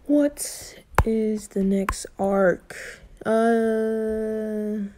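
slow whisper, thinking aloud; slow musing whisper; whisper to self. Real, not acted. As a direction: whisper the question to yourself. Pausing to remember the plot.

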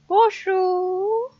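high, rising call of hello; sing-song hello. Pretend. Player greeting the empty studio, high sing-song call. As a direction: call out playfully into the empty room.

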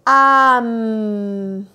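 "Am" held long and loud; blended syllable. Shown as a demonstration. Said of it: "¿Cómo suena ahora?". (sílaba "Am" alargada)

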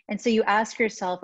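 normal talking voice

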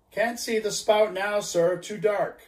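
a lookout shouting down; called down from aloft. Acted. Lookout answers the captain from aloft, calling. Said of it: "cried a voice from the air".

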